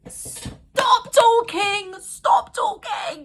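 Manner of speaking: high, slow, drawn-out disgusted shriek; stop talking. Real, not acted. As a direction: stretch it out in horror. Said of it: "reacting to his creepy line".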